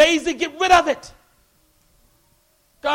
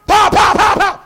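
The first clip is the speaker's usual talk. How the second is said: loud, higher imitated gunfire pops; gunshot noises; 7 semitones higher, 8 dB louder than usual. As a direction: fire off the shots with your mouth.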